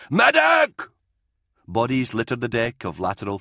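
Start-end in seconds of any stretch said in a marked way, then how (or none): 0.1-0.8 shout. A narrator's reading. Soldier calls for a medic.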